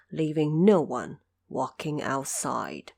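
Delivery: ordinary delivery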